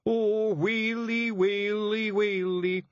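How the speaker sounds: slow, high keening "waily"; hammed-up pixie mourning; wailing lament. A narrator's reading. Feagle lament.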